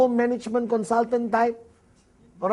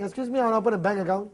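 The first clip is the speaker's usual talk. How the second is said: fast bank request; much faster than usual. Retold or imitated. Acting at the bank.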